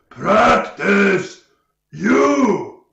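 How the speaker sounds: loud, high-pitched ogre bellow; ogre roar. Acted. Game master as ogre charging at player, roaring.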